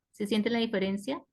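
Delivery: ordinary talk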